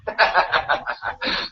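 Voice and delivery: short run of voiceless chuckles; laugh. Real, not acted. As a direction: laugh quietly to yourself. Laughs after calling cat stupid.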